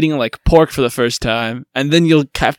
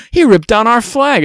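mock outrage; 10 semitones higher, 4 dB louder than usual. Mocking indignant Capitol official.

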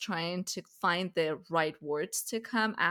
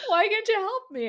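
whiny plea; 13 semitones higher, 7 dB louder than usual. Retold or imitated.

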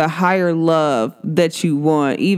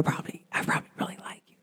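whispering; 16 dB quieter, much faster than usual. Real, not acted. Confessing a crush to viewers.

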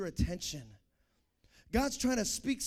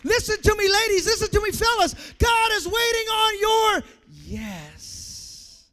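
higher, loud shouted preaching; full-force preaching; 11 semitones higher, 14 dB louder than usual. Acted. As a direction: preach it at full volume to the crowd.

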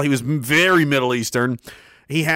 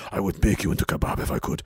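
menacing doctor; 8 semitones lower, 6 dB quieter, faster than usual. Retold or imitated. Doctor threatening.

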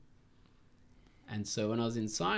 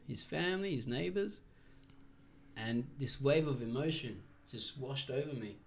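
ordinary delivery

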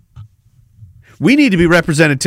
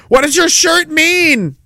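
mock critic voice; 11 semitones higher, slower than usual. Mocking an imagined critic.

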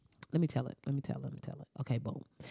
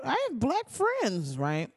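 slow, much higher mocking drawl; sarcastic mockery; 8 semitones higher, slower than usual. Comedian mocking the coach's excuse, slow sarcastic drawl. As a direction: parody the excuse, dragging it out.

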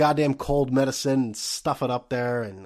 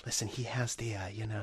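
much softer, half-whispered nurse; nurses whispering; 4 semitones lower, 14 dB quieter than usual. Retold or imitated. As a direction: whisper it like a nurse sharing gossip. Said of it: "So they're just like".

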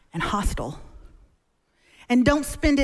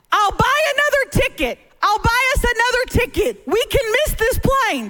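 panicked dad; 8 semitones higher than usual. Retold or imitated. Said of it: "my dad was saying".

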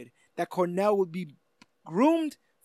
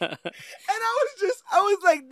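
laughing; 12 semitones higher than usual. Real, not acted. Laughing while saying it.

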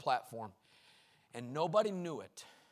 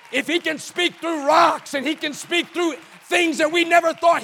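loud, high, shouted sermon cadence; fiery revival preacher, building each line; full-force preaching; 10 semitones higher, 17 dB louder than usual. Acted. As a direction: preach it out to the whole room.

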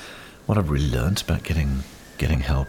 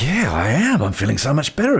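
high, loud, bright mock eagerness; eager yes; 10 semitones higher, 4 dB louder than usual. Telling the osteo he feels better, eager high yes. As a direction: chirp it back like you're all fixed. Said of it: "I went".

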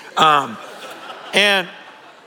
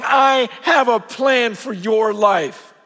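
slow booming proclamation; 2 semitones higher, slower than usual. Acted. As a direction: boom it out like a voice from heaven. God's voice from the bush.

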